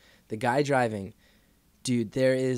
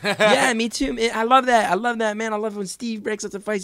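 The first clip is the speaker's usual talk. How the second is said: high, loud, gushing agreement; exaggerated enthusiasm; 12 semitones higher, 7 dB louder than usual. Trapped driver placating scary stranger, over-eager mock enthusiasm. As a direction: agree far too eagerly, voice pitched right up.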